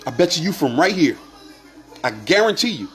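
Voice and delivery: ordinary talk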